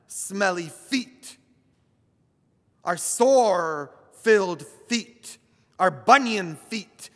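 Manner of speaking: a priest on Holy Thursday preaching about feet, slow and loud; preaching at full force. Acted. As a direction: lift the congregation with each phrase.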